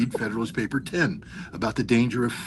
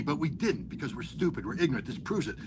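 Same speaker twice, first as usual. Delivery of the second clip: usual speaking voice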